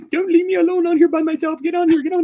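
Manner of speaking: high, loud whiny pleading; mock desperate texter. Mocking his co-host's needy texts in a high whine. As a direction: beg like a clingy friend left alone. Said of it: "And then you're texting me, goes".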